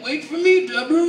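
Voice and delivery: lightly voiced doddering father; senile dad. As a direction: call after his wife like a befuddled old husband. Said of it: "my senile father".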